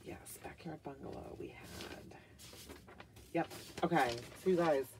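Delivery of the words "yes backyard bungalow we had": whisper. Real, not acted. Checking invoice under breath.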